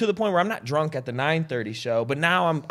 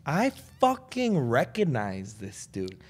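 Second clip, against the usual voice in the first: slow dawning surprise; 6 semitones higher, 12 dB louder, slower than usual. Retold or imitated. Realizing it's the bartender.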